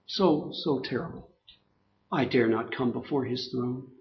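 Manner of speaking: hushed, syrupy fake humility; sarcastic sanctimonious prayer. Preacher mocking a falsely humble worshipper, soft and sanctimonious. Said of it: "I'm being sarcastic here".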